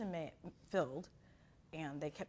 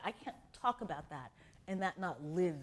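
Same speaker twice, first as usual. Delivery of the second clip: ordinary talk, nothing marked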